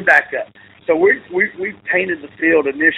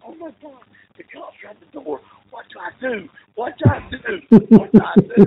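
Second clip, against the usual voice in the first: hushed, higher panic building to loud; mock panicked whisper; 7 semitones higher, 3 dB louder, faster than usual. Friend locked in the bar with cops at the door, mock panic rising from whisper to loud. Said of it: "whisper yelling into the phone".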